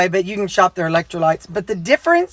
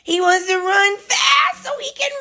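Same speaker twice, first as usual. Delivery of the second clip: usual speaking voice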